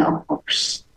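ordinary talk, nothing marked

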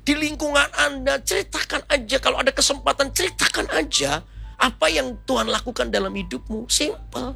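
high-pitched, fervent preaching; preaching exhortation. Real, not acted. Preacher urges congregation to share the gospel, impassioned preaching.

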